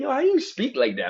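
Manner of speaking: high, loud mock amazement; imitated amazed question. Spanish friends hearing him talk, imitated amazement. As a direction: ask it high, like an impressed friend. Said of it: "They'd be like".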